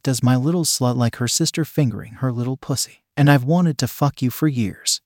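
usual speaking voice

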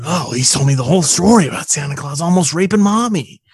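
high, overacted killer voice; mock-dramatic, swooping recital; Ricky. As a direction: ham it up like a bad actor. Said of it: "picture his eyebrows moving a lot when he says it".

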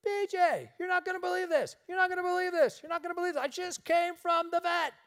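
very high, breathless excitement; hysterical caller. Retold or imitated. Woman phoning B J from the vet, hysterical and high. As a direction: squeal it out, beside herself. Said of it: "she called him hysterical".

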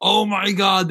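slow, high, drawn-out amazement; excited. Real, not acted. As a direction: stretch it out in awe. Thrilled by wrestling match.

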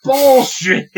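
high loud jeering call; mock heckle. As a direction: yell it out as a heckle.

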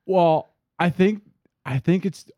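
high, halting search for an excuse; imitated awkward stalling. Friend retold stalling for an excuse.

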